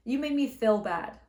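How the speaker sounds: "feel" said short like "fill". Shown as a demonstration. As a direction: say "feel" as "fɪl", short lax vowel. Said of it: "So it sounds like she's saying fill".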